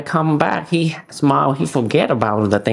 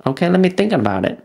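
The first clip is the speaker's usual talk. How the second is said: quick, higher polite brush-off; 6 semitones higher, much faster than usual. Retold or imitated. Telling the roofer he'll think about the price, quick brush-off. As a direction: rattle it off to end the call.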